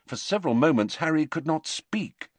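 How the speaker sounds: ordinary delivery, nothing marked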